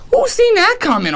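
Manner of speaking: high pitched who's, falling away; mock disbelief. Real, not acted. Vlogger marveling at Vrabel firing, high rhetorical question. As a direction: sell the surprise on Who's.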